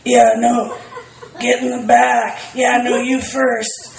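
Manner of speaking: slow, creepy sizing-up voice; eager, sinister coaxing; Buffalo Bill impression. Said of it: "that was my Buffalo Bill impression".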